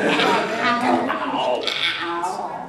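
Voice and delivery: Gal, gal, gal repeated in an eerie half-whispered character voice; creepy Gal voice performed for a fan. Shown as a demonstration. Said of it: "It's creepier than ever".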